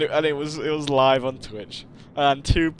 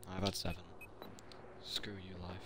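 ordinary talk, nothing marked

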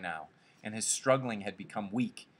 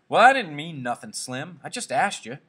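higher, loud protest; defensive; 6 semitones higher, 10 dB louder than usual. Acted. Curly answers scowling Slim's irritation, defensive and raised. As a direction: protest your innocence, pitch raised.

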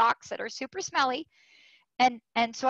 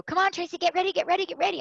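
excited calling; 7 semitones higher, faster than usual. Retold or imitated. Sailors calling her to race.

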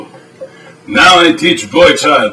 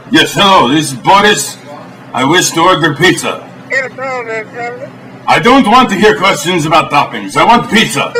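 broken-English Russian host, deadpan; mock Eastern European TV host; fake Russian accent. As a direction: play a stern Russian cook in broken English. Boris cooking show sketch.

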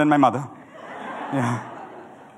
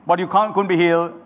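usual speaking voice

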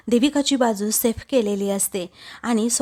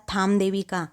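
ordinary delivery

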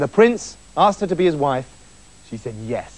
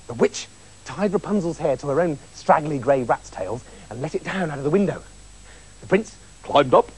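usual speaking voice

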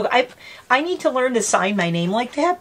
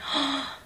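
soft surprised intake of breath; delighted gasp; 6 semitones higher, 7 dB quieter than usual. Real, not acted. Opening a friend's gift, a soft delighted gasp.

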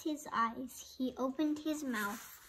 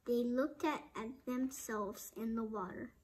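ordinary delivery, nothing marked

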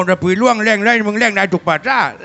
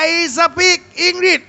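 high slow English; 7 semitones higher, much slower than usual. Acted. Character shows off English.

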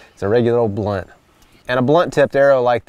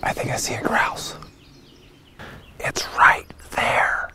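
hushed whisper while stalking; 9 dB quieter than usual. Real, not acted. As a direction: breathe the words, no voice.

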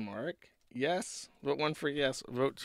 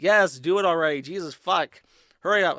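impatient shout; 5 semitones higher, 10 dB louder than usual. Retold or imitated. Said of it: "the Pharisees are like".

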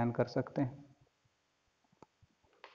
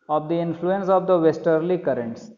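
slow emphatic reread; 4 semitones higher, 6 dB louder, much slower than usual. Shown as a demonstration. Rereads a line to explain.

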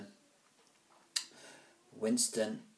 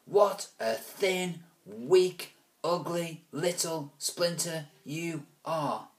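slow, high, louder sneering taunt; sneering bully; 7 semitones higher, 3 dB louder, much slower than usual. Acted. Alvis taunting Ruskin while holding him up, sneering. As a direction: draw out each insult. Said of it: "Alvis said".

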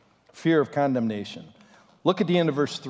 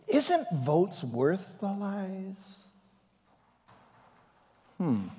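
sly tempter; 4 semitones higher, 6 dB quieter, slower than usual. Retold or imitated. Satan tempting, slow sly tone.

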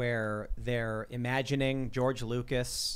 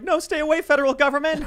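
high shrill plea to stay away; mock shriek; 12 dB louder than usual.

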